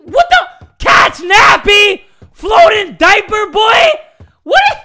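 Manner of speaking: high, very loud, drawn-out yelling; shocked shouting. Real, not acted. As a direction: yell it in utter disbelief. Shocked by flying baby in game.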